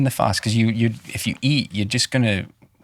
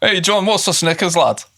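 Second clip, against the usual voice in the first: lightly put-on Scouse accent, higher; a hint of Scouse, higher and playful; 9 semitones higher than usual. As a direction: tease John in a mock Liverpool voice. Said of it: "do your Scouse accent".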